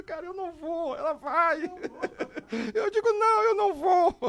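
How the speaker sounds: very high, laughing mock terror; terrified refusal. Fan refusing to go in, high and laughing. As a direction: squeal the refusal, half laughing.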